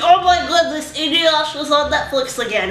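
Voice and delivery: high, loud giddy impression of a friend; imitating friend's excitement. Friend Miguel gushing by message, voiced high and loud. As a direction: gush like an overexcited buddy. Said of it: "And that's exactly how he sounds".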